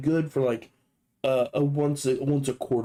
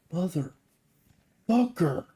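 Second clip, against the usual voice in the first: drawn-out exasperation; 3 semitones higher, much slower than usual. Retold or imitated. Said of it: "You're just like".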